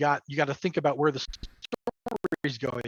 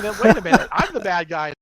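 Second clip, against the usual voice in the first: louder, higher sudden realization; surprised realization; 4 semitones higher, 7 dB louder than usual. Retold or imitated. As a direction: realize with a jolt you're the villain. Player realizing guilt, acted.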